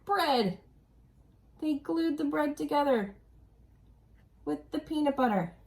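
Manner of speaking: usual speaking voice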